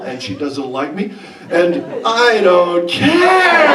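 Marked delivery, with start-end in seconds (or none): 1.5-3.7 slow, much higher and louder gleeful cry; giddy delight. Real, not acted. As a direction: revel in floating on the gas. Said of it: "I'm floating".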